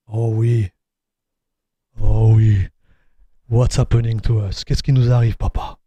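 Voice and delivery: low, mock-French inner voice. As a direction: play a deep French alter ego. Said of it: "my inner voice become French".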